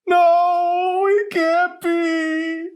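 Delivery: put-on an old woman breaking at the news; voice cracking. A narrator's reading. Grandma hearing her husband is dead, cracked high wail. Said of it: "her voice cracked".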